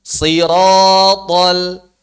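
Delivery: siratal with thick, rounded vowels, very slow; heavy siratal. Shown as a demonstration. Teacher models siratal for students to repeat. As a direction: draw out siratal slowly with a heavy sound.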